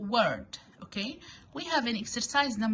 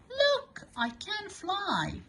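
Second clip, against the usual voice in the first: put-on much higher, a child's cry of delight; excited child; 11 semitones higher, slower than usual. Teacher voicing a child in the example sentence, excited. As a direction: squeal it like a little kid showing off. Child's line in reading exercise.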